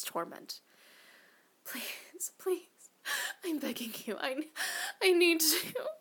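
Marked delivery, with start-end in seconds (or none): 1.6-5.9 high, broken pleading between gasps; stuttering, breathing hard. Acted. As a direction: stammer and beg, gasping between words. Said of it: "I stuttered, breathing hard between each word".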